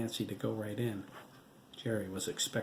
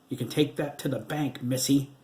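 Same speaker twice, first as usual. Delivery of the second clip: sharp retort, louder on missy; fired back; 4 semitones higher, 6 dB louder than usual. A narrator's reading. Ghost husband firing back at his widow, sharp and louder. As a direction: fire it straight back at her. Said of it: "Wade fired back".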